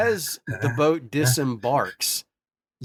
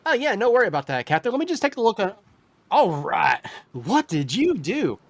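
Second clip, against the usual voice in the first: higher, brighter sailor voice; eager crewman; 9 semitones higher than usual. Acted. Healer answering the captain's call. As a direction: rush in ready to help. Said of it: "Banda voiced".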